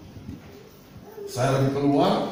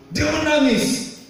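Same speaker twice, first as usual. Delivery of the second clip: drawn-out preacher's shout; emphatic shout; 11 semitones higher, slower than usual. Acted. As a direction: drag it out and shout it to the congregation. Preacher dramatizes Hezekiah's weeping.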